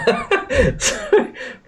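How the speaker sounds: high, breathy, voiceless laugh; breathless laugh. Real, not acted. Streamer cracking up at the absurdity, breathless laughing. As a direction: let a helpless laugh escape. Laughs after long pause.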